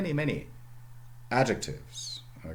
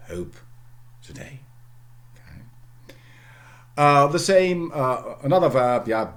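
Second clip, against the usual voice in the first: ordinary delivery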